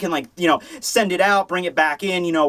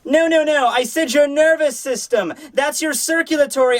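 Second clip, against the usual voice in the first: exasperated high correction; 7 semitones higher than usual. Acted. Mayuri correcting Nemu's fatal mistake, high and exasperated. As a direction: scold in a high put-on voice.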